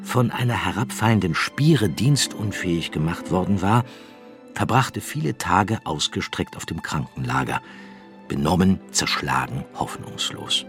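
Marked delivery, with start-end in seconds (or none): none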